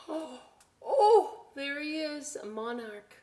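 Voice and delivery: high, loud thrilled oh; a big excited oh, oh; delighted gasp. Pretend. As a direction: gasp in wonder for the kids. Butterfly emerging on the page.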